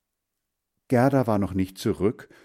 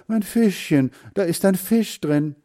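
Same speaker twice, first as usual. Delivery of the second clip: high, louder desperate plea; pleading child; 10 semitones higher, 5 dB louder than usual. Acted. Boy pleading to keep his little fish, high and urgent. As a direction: beg to save the fish. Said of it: "rief Hans Frieder".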